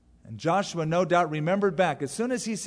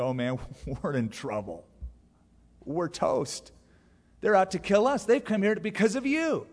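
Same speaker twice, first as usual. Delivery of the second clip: mock nervous panic; 4 semitones higher than usual. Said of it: "he gets all nervous".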